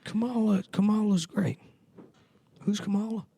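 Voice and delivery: high-pitched befuddled old-man impression; praise, then lose track, in a put-on old voice; Joe Biden. Mock president forgetting his running mate, befuddled old-man voice.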